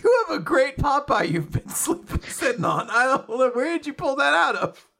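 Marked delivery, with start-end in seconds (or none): 0.0-3.9 high, laughing voice; talking through a big laugh; real laughter. Real, not acted. Cracking up at the Popeye impression.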